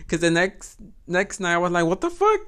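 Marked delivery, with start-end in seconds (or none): 1.9-2.4 high, squeaky what the fuck; shocked. Retold or imitated. Recalling the next night, shrieking in disbelief. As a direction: disbelief shooting up in pitch.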